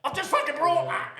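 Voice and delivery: very high, loud squawk of outrage; imitating a friend's furious protest; mock outraged yell. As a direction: yell in high-pitched disbelief. Friend's outrage at smashed phone.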